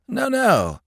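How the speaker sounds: quick, high protest; hasty. A narrator's reading. Mister Waller to Smith, hastily protesting. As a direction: blurt it out fast to take it back. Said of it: "said Mister Waller hastily".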